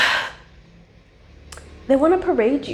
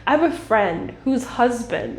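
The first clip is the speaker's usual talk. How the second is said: usual speaking voice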